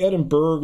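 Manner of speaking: "Edinburgh" with a hard "burg" ending; American "Edinburgh", hard final G; Californian pronunciation. Shown as a demonstration.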